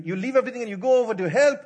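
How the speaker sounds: ordinary talk, nothing marked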